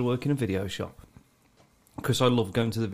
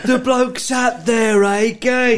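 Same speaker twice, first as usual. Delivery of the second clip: very slow, high, loud proclamation; mock announcement; 11 semitones higher, 8 dB louder, much slower than usual. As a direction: drag it out like a town crier.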